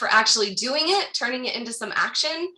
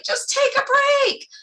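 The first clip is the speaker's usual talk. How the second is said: much higher, chirpy mock advice; breezy advice voice; 5 dB louder than usual. Mimicking the audience advising a tired friend, much higher and chirpy. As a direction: toss off easy advice in a bright high voice. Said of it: "And you're like".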